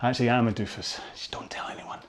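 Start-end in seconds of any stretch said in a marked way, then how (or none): 1.1-2.0 hushed confidential aside; very soft, voiceless stage secret; mock-secret whisper. Jokey confidential aside to viewers.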